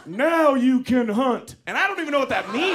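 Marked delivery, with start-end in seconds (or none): 0.1-1.6 slow, weighty pronouncement; solemn Texas mom. Retold or imitated. Mother pulling son from water, slow solemn declaration. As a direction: intone it slowly like a ritual. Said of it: "then she goes".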